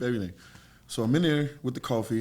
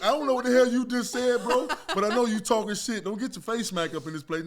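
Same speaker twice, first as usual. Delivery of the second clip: higher and louder, half-laughing threat; mock angry warning; 10 semitones higher, 4 dB louder than usual. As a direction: warn him off with a laugh in it. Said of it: "Now I'm getting madder".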